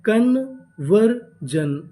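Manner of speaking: very slow, drawn out syllable by syllable; slow spelled-out build. Shown as a demonstration. Teacher slowly blending the word for the class. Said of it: "तो पूरी meaning क्या बनी?".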